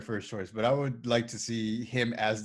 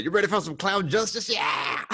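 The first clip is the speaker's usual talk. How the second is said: higher, hyped-up battle call; hammed-up clown avenger; 6 semitones higher than usual. Co-host playing the character as the clown, mock rallying cry. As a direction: whoop it up like a movie hero. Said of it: "see him dressed up like it doing that".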